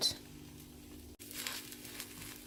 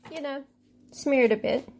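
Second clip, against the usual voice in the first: usual speaking voice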